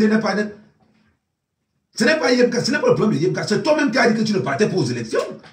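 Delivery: high, loud, fast reproach; exasperated scolding. Acted. Scolding the crying professor, loud and high-pitched. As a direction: berate him at the top of your voice.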